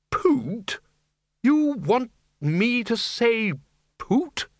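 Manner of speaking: high, slow, disbelieving repeat of poot; incredulous outrage. Acted. Arch-Chancellor appalled at being offered a silly substitute swear, incredulous. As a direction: stretch it out in pure disbelief.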